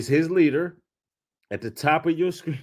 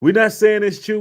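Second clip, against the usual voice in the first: mocking quote; 6 semitones higher, 6 dB louder than usual. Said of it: "And y'all being soft on this man".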